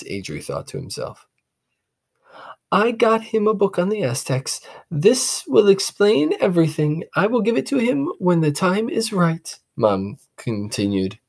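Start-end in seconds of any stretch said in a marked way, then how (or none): 2.7-9.6 high, secretive mother; mother plotting a surprise; mom's voice. Acted. Mom speaks.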